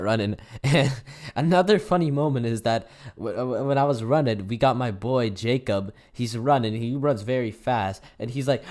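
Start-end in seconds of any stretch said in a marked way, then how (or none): none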